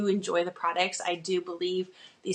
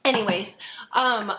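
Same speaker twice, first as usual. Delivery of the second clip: usual speaking voice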